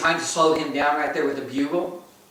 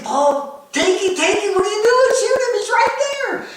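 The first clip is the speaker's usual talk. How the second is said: high, louder urgent plea; urgent coaching; 3 dB louder than usual. Retold or imitated. Father urging his hunting partner to shoot, urgent and high. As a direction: urge him on, pitched way up. Urging son to take shot.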